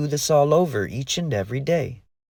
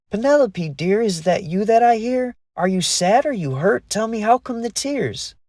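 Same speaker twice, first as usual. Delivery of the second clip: high, caring fairy voice; a fairy gently asking what's wrong; worried fairy; 9 semitones higher than usual. A narrator's reading. Lee worried for crying friend.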